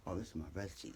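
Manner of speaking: usual speaking voice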